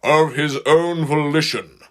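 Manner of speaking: slow, loud insistence on "of his own volition"; insistent. A narrator's reading. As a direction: lean hard on every word of "of his own volition". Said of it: "he added with insistence".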